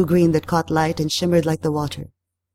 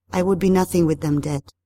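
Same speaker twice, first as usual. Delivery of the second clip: plain reading voice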